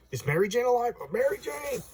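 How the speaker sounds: high, wailing mock panic; panicked calling out. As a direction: call out for a loved one in comic alarm. Spider-Man cries out for Mary Jane.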